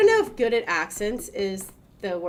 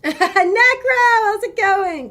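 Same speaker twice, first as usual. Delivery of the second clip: excited greeting; 14 semitones higher, 13 dB louder, much slower than usual. Real, not acted. Greeting a chatter by name.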